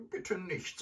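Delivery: put-on high, timid old-lady plea; pleading grandmother. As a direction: beg in a frightened high voice. Grandmother pleads at pistol point.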